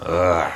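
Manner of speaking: deep quiet ugh; low disgusted grunt. Retold or imitated. Preacher imitating the dark feeling of worldly music, low grunt. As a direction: groan it out low. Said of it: "It's a".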